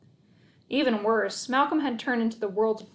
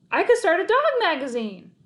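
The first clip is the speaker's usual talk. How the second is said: excited, higher and louder; thrilled shout of a new scheme; 8 semitones higher, 6 dB louder than usual. A narrator's reading. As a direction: burst out with the idea. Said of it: "I shouted".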